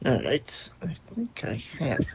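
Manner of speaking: whisper. Real, not acted. Muttering roll aside.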